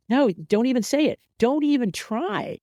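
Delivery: high, exasperated protest; hammed-up outrage, voice shooting up; mock indignation. Mock protest against a fake Jan.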